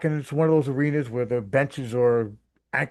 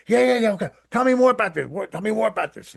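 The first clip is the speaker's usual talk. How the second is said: eager; 9 semitones higher, 5 dB louder than usual. Retold or imitated. Hawk eager to hear more.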